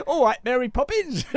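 teasing bad Cockney accent like Mary Poppins; mock Cockney accent. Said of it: "he has such a bad English accent".

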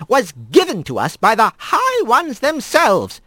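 hard, measured rebuke. Acted. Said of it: "her voice as hard as Rat had ever heard it".

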